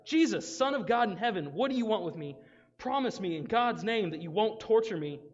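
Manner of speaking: loud, high desperate plea; shouted. Acted. Possessed man shouts at Jesus, loud desperate plea. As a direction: cry out to Jesus in terror. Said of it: "He shouted".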